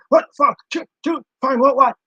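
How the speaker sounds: high, loud mock outrage; mock mad Amber. Vlogger mimicking Amber getting mad, high shrieky mock anger. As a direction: squawk it like she's losing it. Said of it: "she's getting mad".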